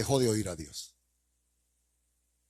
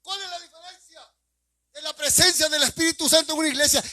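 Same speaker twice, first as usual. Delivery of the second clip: pressing high question; 13 semitones higher, 4 dB louder than usual. Real, not acted.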